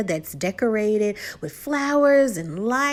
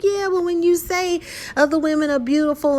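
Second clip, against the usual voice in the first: mock accusing wife; 8 semitones higher than usual. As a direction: push back like the hurt wife. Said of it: "she's like".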